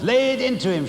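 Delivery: high, gleeful shriek egging him on; shrieking Missus Pratchett. A narrator's reading. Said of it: "she was shrieking".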